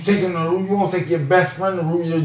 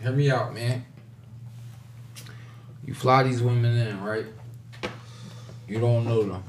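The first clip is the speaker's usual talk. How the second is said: quiet; 8 semitones lower, 12 dB quieter than usual. Real, not acted. Lowered voice giving advice.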